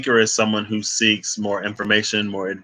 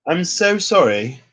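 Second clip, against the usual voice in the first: put-on apology voice; 6 semitones higher, 3 dB louder than usual. Real, not acted. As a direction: say sorry in a sweet, higher put-on voice. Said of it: "I don't know why I talk like this".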